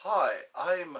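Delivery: usual speaking voice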